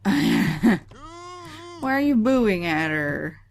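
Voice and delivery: mock-outraged scold at the screen; loud scolding. Real, not acted. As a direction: call out the Sim for booing. Scolding a game character.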